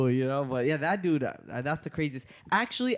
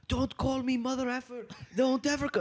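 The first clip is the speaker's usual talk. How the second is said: higher-pitched imitation of an offended heckler; mock angry heckler; 10 semitones higher than usual. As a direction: lightly play the offended man. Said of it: "He goes".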